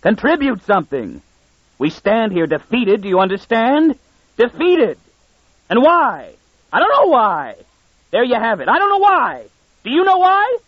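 high strained furious rant; angry lord berating his calm old minister; raging. Acted. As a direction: rage at him, voice climbing to a screech. Stetton ranting at his minister.

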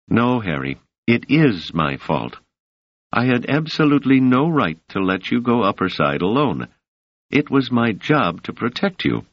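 a hint of the young woman, measured and soothing; Doris voice.

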